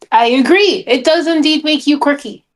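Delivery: normal talking voice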